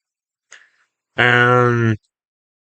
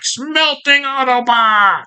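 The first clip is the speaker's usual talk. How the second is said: much higher, drawn-out mock screech; Starscream; 11 semitones higher, slower than usual. Freaking-out Starscream blasting everywhere, high mock yell. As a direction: cry it out high and slow. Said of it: "Starscream's freaking out".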